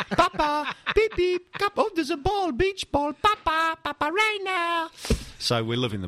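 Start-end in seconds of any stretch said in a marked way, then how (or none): 0.1-4.9 high, squeaky clown voice; silly clown. Retold or imitated. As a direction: play the goalkeeper as a babbling clown. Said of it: "He just sounds like a clown".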